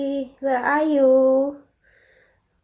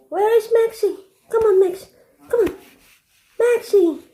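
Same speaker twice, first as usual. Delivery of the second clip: calling out high; 7 semitones higher, faster than usual. Real, not acted. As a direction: call the dog from across the house. Calling the dog, higher.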